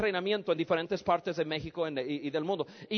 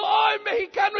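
high, loud, stretched-out cry; overjoyed greeting; 9 semitones higher, 5 dB louder than usual. Retold or imitated. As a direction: imitate a gushing bear-hug welcome. Retold joyful greeting before a kiss.

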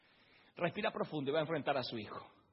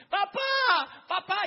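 grito muy agudo y fuerte; llama desgarrado a papá; grito de niño; 11 dB louder, much slower than usual. Acted. Isaac grita a su padre. (Isaac en el altar llama a su padre, gritando)

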